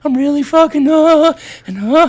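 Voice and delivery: high-pitched mock worry; imitating worried wife. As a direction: play the fretting wife, voice climbing high. Acting out his worried wife on phone.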